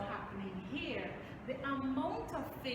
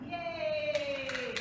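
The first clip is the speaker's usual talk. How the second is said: bright, loud yay; excited cheer; 5 semitones higher, 6 dB louder than usual. Acted. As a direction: cheer for the kids. Cheering after the experiment.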